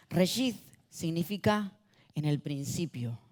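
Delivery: normal talking voice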